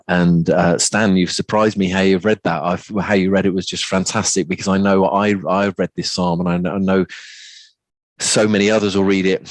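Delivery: normal talking voice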